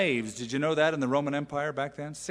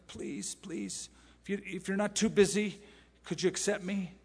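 timid Jesus; 5 semitones higher, 4 dB quieter than usual. Retold or imitated. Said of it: "timidly knocking on the door of your heart saying".

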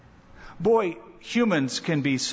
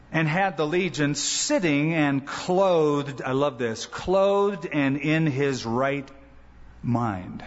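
slow, savoring; 3 semitones lower, slower than usual. Acted. Savoring the healed man's state.

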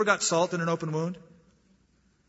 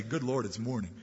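groaning dread; 5 semitones lower, 6 dB quieter than usual. Pretend. Worldly man dreading another morning, low weary groan. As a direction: lower and softer, a weary groan with a laugh.

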